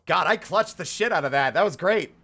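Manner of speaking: real excitement, laughing at the end; excited. Real, not acted. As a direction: crow about the clutch win. Celebrating a clutch boss win.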